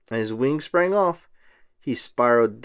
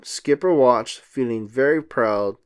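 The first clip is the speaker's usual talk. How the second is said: usual speaking voice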